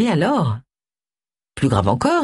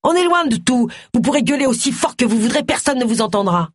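high, raised taunting yell; yelled threat; 7 semitones higher than usual. A narrator's reading. Luc tells his captive nobody will hear her, yelling. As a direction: call it out high, as through a shut door. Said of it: "hurla Luc".

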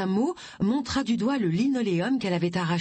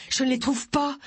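high, mildly winded report; understated breathless urgency; out of breath; 7 semitones higher than usual. A narrator's reading. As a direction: report as if just arrived running. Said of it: "dit-il à bout de souffle".

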